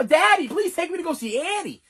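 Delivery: put-on very high, urgent little-boy plea; hammed-up childish begging. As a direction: plead with Daddy in a kid voice. Acting child begging dad.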